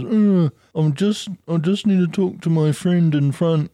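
high, slow simpering excuse; mock the queue jumper; whiny line-cutter. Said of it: "comes up and goes".

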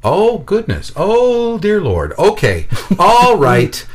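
high, drawn-out dismayed exclamations; mounting shock, barely kept polite; tone turning alarmed. Retold or imitated. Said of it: "you hear her tone change".

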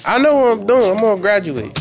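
loud and emphatic. Real, not acted. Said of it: "I said".

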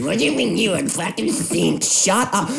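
high-pitched mock outburst; a comic fed-up rant; mock exasperation. Podcaster ranting at show-off posters, mock exasperated.

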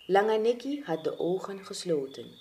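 plain reading voice

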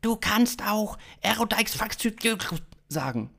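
high-pitched alien voice; high alien with garbled syllables; alien stranger. A narrator's reading. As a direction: offer it in a high extraterrestrial voice.

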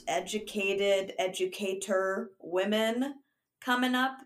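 ordinary talk

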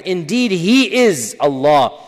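slow, solemn emphasis; emphatic translation. Shown as a demonstration. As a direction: lean on each word. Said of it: "mechanisms to emphasize".

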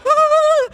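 high, loud yelp of panic; a sudden high shriek of fear; panicked yelp. Retold or imitated. Girl hearing she's caught, high panicked yelp. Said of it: "I'm like".

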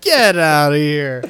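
loud, drawn-out playful dismissal; hammed-up loud disbelief; mock outrage. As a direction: stretch it out in a big teasing shout.

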